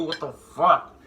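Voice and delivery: loud disbelieving curse; shocked outburst. Real, not acted. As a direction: blurt it out in pain. Pain from spicy food.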